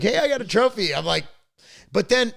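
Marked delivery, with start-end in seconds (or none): 0.0-0.9 high, cheerful son imitation; happy kid voice. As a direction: chirp it like a kid showing off a prize. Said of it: "He's like".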